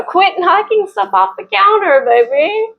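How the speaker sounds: pet talk. Real, not acted. Scolding cat in a pet voice.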